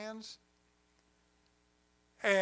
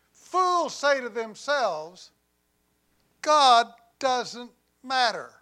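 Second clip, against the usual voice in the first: slow scripture reading; 4 semitones higher, 6 dB louder, slower than usual. Acted. Reads scripture slowly.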